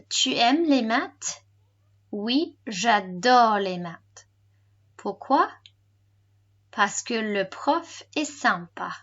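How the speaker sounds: slow model French for learners. Shown as a demonstration. Teacher repeats the recorded dialogue for learners, slowly. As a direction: draw the phrases out, with pauses. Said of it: "And slower".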